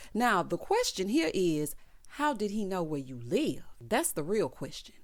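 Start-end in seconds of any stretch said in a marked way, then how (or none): none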